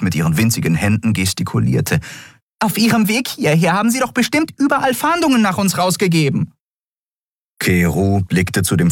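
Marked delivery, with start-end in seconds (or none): 2.6-6.5 high, fast, agitated chatter; agitated fairy. Acted. Nelen fretting at Keru, high and fast. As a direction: gush the worry out quickly.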